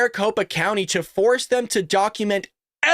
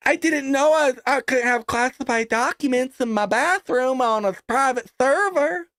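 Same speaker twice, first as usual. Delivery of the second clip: put-on Southern accent; 4 semitones higher than usual. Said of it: "randomly did an, uh, a Southern accent".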